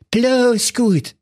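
very high, flustered comic king; a panicking king shouting orders; king losing his head over his missing daughter, high and agitated. Acted. King relieved.

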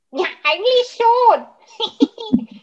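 laughing through the words. Real, not acted. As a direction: chuckle while answering, slow. Laughs at the question.

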